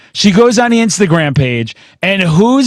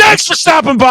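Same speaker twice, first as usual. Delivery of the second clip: excited; 9 semitones higher than usual. Real, not acted. Enthusiastic sign-off.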